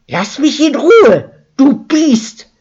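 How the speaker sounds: high, loud fending-off scream. A narrator's reading. Maus fends off the raging daughter: high loud scream. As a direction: scream at her to back off. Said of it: "schrie Maus".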